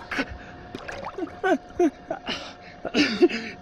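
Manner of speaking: high shaky laugh in cold water; pained high laugh. Real, not acted. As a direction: laugh through the cold shock. Cold plunge reaction.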